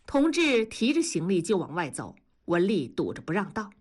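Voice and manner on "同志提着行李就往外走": usual speaking voice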